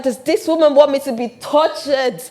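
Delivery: high, loud, dramatic protest; exasperated. Retold or imitated. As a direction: cry out in mock outrage. Retelling her exasperated childhood reaction.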